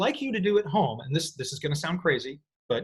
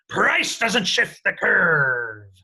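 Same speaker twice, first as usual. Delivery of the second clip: slow, loud, higher pirate growl; 4 semitones higher, 6 dB louder, much slower than usual. Shown as a demonstration. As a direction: bellow it like a pirate captain. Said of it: "On three, we're gonna do it together".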